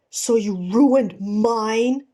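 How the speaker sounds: slow, loud furious retort; roar. Acted. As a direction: roar it back in wounded rage. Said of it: "Ed roars".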